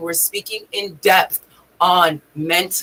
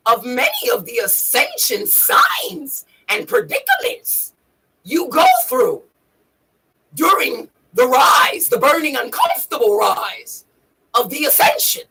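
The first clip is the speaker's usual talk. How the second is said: high, strained creature voice; Yoda; 6 semitones higher than usual. Acted. Teaching viewers about the ascension, Yoda voice. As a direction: stretch the key words in a put-on alien croak. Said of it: "I'm using my Yoda voice".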